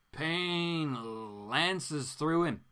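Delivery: ordinary talk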